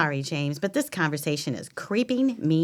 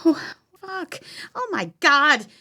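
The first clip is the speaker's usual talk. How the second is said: horrified; 9 semitones higher than usual. Acted. Finds finger in vase.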